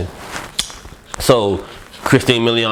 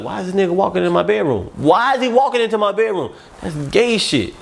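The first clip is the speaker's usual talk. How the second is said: alarmed inner voice; 9 semitones higher than usual. Retold or imitated.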